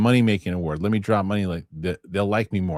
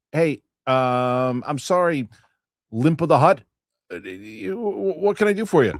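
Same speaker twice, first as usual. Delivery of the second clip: a hint of a nervous vendor, higher; hesitant shopkeeper; 5 semitones higher than usual. Host voicing a vendor greeting Jabba, lightly nervous. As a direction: stammer it like a timid trader.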